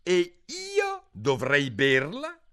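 slow, high, scornful sneer; deep contempt. Acted. Pigeon scoffs at Alice's claim, scornful. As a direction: drip with scorn. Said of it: "in tono di profondo disprezzo".

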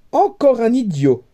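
high, exasperated cry; princess exclaims. A narrator's reading. Princess to the court, crying out in exasperation. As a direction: throw the insult out in exasperation. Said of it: "s'écria la princesse".

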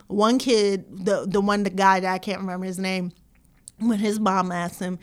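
usual speaking voice

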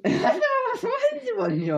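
very high, slow laughing put-on voice; mock squeaky voice. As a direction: squeal it as a joke. Mocking teacher voice, laughing. (quietschige Spottstimme)

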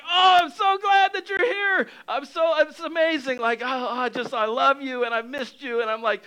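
overjoyed Jesus. Acted. Jesus welcoming you home, a high joyful shout. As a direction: burst out with joy, then settle into tender love. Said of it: "And Jesus is so excited to see you".

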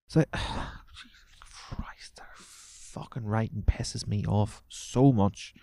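slow fed-up whisper under the breath; exasperated whisper. Real, not acted. Exasperated aside about the column.

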